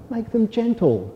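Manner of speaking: high, small lamb voice; gentle lamb. A narrator's reading. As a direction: plead like a little lamb. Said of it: "said the lamb".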